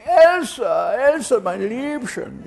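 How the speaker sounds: high, loud, drawn-out Austrian call; mock-Austrian cooing call, loud and high; Joy Adamson calling out. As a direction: imitate a woman calling her pet from afar. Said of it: "then I heard Joy saying".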